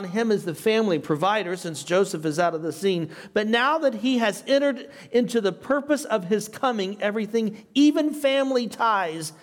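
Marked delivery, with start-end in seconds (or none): 3.3-9.3 higher-pitched, emphatic reading of a quotation; reading aloud. Acted. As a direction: read the commentary out with lifted pitch. Reads the commentary quote on.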